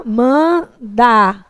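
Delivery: MANDATO broken into drawn-out syllables with pauses, man... da... to. Shown as a demonstration. Teacher showing students the syllable division, Mandato said syllable by syllable. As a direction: say Mandato very slowly, split syllable by syllable. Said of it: "na separação silábica".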